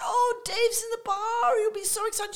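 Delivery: high, loud gushing; high-pitched mock excitement; excited chatter. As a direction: act out a thrilled friend with news. Acting out an excited reunion.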